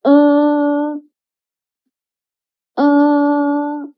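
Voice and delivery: uh held long and level, lips spread; smiling o vowel. Shown as a demonstration. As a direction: say o through a slight smile for uh. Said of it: "with a smile face".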